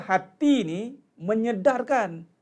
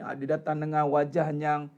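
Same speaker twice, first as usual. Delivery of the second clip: ordinary talk, nothing marked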